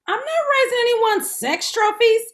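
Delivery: high, loud scandalized protest; indignant. Acted. Woman rejecting a dating match with kids, high and loud. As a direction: recoil in mock horror.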